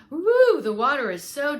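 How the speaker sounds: high loud woo; hammed-up fox cry; fox exclaiming. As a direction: whoop it like a sly fox in water. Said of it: "he insisted".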